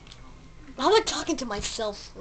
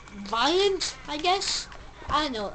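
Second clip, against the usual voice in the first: ordinary talk, nothing marked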